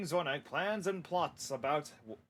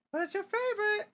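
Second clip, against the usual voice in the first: much higher coaxing mom voice. Acted. Mom coaxing her son about dinner, high pleading. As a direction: coax like a mother.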